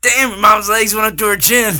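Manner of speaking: high, loud hammed-up Swayze line; shouted Swayze impression. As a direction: belt it out as a cocky Swayze. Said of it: "Trying too hard on your Swayze".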